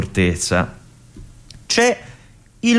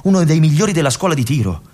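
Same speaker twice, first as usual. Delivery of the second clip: ordinary talk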